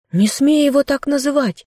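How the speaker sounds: high, sharp cry at him; girl shouting at her captor for his words. Acted. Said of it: "крикнула она на него".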